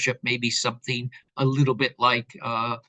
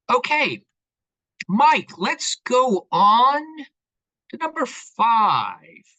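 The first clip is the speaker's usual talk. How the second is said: loud and slow, called across the room; calling out; 9 semitones higher, 6 dB louder, slower than usual. Real, not acted. Calling to Mike at the back for the next slide, loud and slow.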